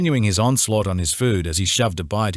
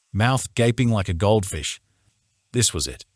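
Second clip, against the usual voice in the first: usual speaking voice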